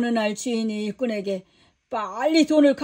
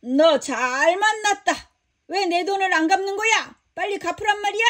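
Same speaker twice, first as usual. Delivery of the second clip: angry outburst; 7 semitones higher than usual. Acted. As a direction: pitch climbing higher, demanding. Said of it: "버럭 화를 내며 말했어".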